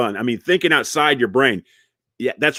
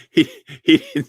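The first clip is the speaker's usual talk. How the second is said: normal talking voice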